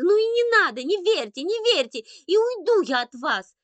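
put-on high, loud childish defiance; hurt, wailing tantrum; inconsolable tantrum. A narrator's reading. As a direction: storm off shouting like a little boy. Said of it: "Андрейка никак не хотел успокаиваться".

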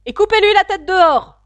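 very high, loud royal shout; furious queen. A narrator's reading. The queen orders an usher to behead him, shouting. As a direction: shriek the death sentence.

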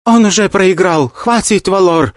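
high pleading shout. Acted. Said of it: "закричала Кинг Джао".

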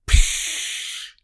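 unvoiced hissing tyre noise; flat tire hiss. A narrator's reading. Pete imitates a flat tire for the group, hissing. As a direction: puff out a deflating tire sound. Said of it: "видає звук спущеної шини".

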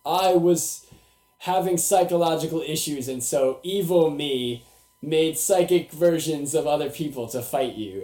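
lightly hammed-up confessing mentor, slowing down; Professor Xavier. Mocking the mentor's lame twist explanation, mock-solemn. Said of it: "Professor Xavier comes out, he's like".

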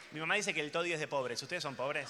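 usual speaking voice

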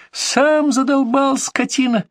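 high, shrill hysterical scream of a wife; hysterical shouting. Acted. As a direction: shriek it in a fit of rage. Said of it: "истерично закричала жена". (истеричный крик жены)

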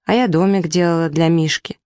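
plain reading voice

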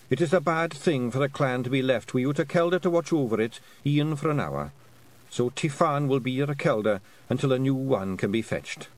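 put-on a sly old Scottish matriarch sniffing out a treat; lightly voiced old Scottish woman, teasing; old Scots Kelda. Kelda addressing the clan.

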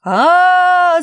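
long high jeering yell; children crowd jeering roar; crowd shout. Acted. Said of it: "закричали зрители".

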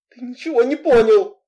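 high, loud, exasperated outburst. A narrator's reading. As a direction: raise your voice in anger. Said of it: "Разозлившись, я повысила голос".

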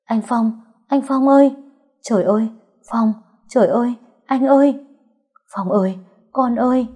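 high, loud cries of alarm; screaming. A narrator's reading. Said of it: "tôi đã nghe tiếng hét".

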